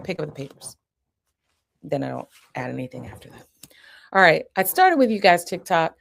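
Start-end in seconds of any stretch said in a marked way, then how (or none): 0.0-0.8 softer calm command; soft hand-over-hand prompt; quiet firm instruction. Shown as a demonstration. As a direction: say it gently while guiding his hands. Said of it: "Hand over hand".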